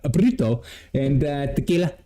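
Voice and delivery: overdone Spanish pronunciation of burrito and tequila; mock Spanish accent on the food words; sudden Spanish accent. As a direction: switch into a showy Spanish accent. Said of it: "all of a sudden they speak".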